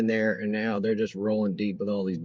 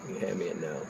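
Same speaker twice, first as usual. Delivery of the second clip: quiet aside; 5 semitones lower, 9 dB quieter than usual. Real, not acted.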